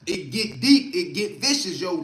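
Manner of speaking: ordinary talk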